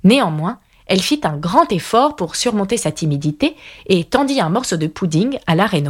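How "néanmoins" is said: usual speaking voice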